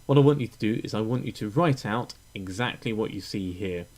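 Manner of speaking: usual speaking voice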